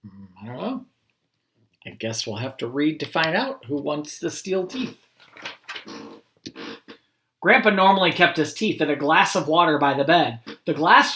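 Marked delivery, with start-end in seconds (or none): none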